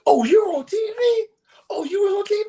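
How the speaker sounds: squealing high and thrilled, repeating it; mock starstruck gasp. Teller mocking a friend's gasp, high and squealing. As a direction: play the gushing fan. Friend's over-the-top reaction retold.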